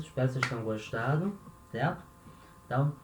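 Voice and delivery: ordinary talk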